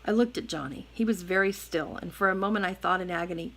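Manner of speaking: plain reading voice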